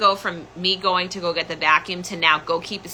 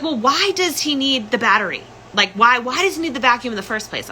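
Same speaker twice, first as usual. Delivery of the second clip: higher, insistent questioning; pitched-up mock interrogation; retold questioning mother; 6 semitones higher than usual. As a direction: imitate a mom demanding an explanation. Retold mother questioning her suspiciously.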